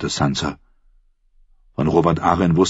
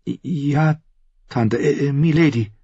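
put-on higher, halting young voice; timid girl; 10 semitones higher, slower than usual. A narrator's reading. Sansa blushing as she answers her aunt, hesitant. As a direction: stammer it out, embarrassed.

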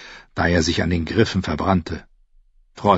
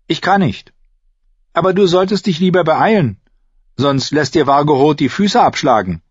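put-on higher, urgent warning girl; young girl voice; 8 semitones higher, 4 dB louder than usual. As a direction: warn her to hurry up. Arya voiced in story.

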